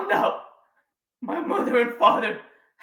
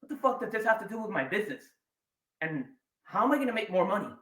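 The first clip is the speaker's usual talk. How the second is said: impatient audience member; 8 dB quieter, faster than usual. Retold or imitated. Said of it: "you're like".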